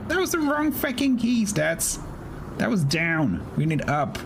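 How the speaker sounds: high frantic yelping. Real, not acted. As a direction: yelp it in a frantic rush. Frantic about dive planes.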